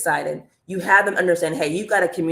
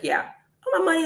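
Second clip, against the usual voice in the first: high, eager fan begging for the money; mock-eager viewer voice, pitched way up; 10 semitones higher than usual. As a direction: play an excited commenter pleading.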